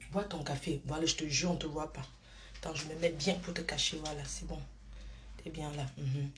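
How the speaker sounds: hushed and low, hiding from someone nearby. Real, not acted. Hiding the phone from family, talking low and hushed. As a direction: keep it low so nobody notices.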